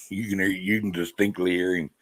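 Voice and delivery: ordinary talk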